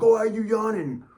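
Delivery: very high, loud mock outrage; mock flipping out. Coach catches you yawning, hammed-up flip-out. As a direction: imitate a coach overreacting wildly. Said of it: "they'll flip out on you".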